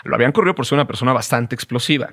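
fast, brisk repeat; a fast throwaway restatement; quick recap. Shown as a demonstration. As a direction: rattle it off quickly as a reminder. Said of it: "Esto nos va a servir más adelante".

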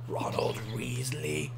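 slow, low whisper; drawn-out, very soft voice; hushed naming. Pretend. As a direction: whisper the name slowly. Names the deer theatrically.

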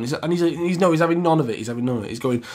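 ordinary delivery, nothing marked

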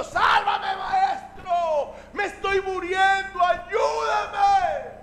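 high, drawn-out desperate cries; acted shouting for help. Drowning Pedro calling to his master, high desperate shouting. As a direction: yell as if going under, pitched well up. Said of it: "él sigue gritando".

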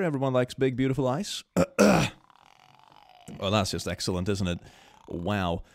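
ordinary talk, nothing marked

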